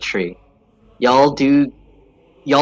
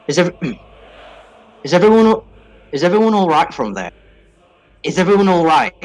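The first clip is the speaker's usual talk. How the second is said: higher, louder, fast call across the battlefield; 6 semitones higher, 4 dB louder, faster than usual. Acted. Silverback calling to the party after the battle, loud and quick. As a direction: shout a quick check on everyone. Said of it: "he kinda calls out".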